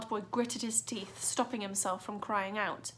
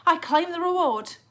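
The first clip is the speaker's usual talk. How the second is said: loud high greedy shout; officer grabbing for reward; greedy cockney copper; 8 semitones higher, 10 dB louder than usual. A narrator's reading. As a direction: yell to claim the prize.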